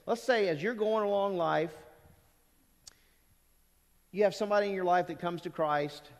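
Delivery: ordinary delivery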